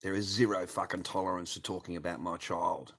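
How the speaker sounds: ordinary talk, nothing marked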